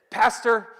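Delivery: pastor called out high and loud. Retold or imitated. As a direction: call out Pastor with a lift. Text message quoted, loud call.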